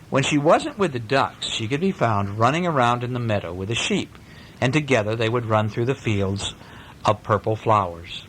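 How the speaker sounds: ordinary delivery, nothing marked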